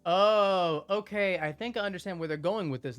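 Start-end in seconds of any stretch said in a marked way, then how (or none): 0.1-1.4 loud surprised oh; startled oh, okay; taken aback. Real, not acted.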